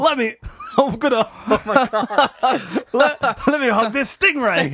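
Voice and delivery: high mock Australian through laughter; bad Australian accent. Playing a stingray-hugging Australian, bad Australian accent. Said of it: "worst Australian accent ever".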